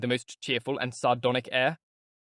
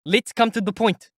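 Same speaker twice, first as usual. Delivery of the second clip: loud, high and earnest; raised earnest voice; loudly and earnestly; 8 semitones higher, 5 dB louder than usual. Acted. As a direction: demand his attention. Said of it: "he said, loudly and earnestly".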